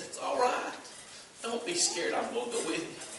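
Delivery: voiced mother, hushed and breaking up; soft, near-whispered comfort; tender reassurance. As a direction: soothe a frightened child, very softly. Mother comforting frightened child in story.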